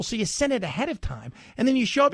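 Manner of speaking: normal talking voice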